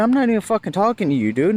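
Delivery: fast, high, indignant retort; retold cussing out. Driver to a cop who interrupted, retold indignation. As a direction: re-enact brushing off a butting-in officer. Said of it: "I ended up cussing this one out".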